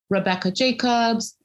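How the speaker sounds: ordinary delivery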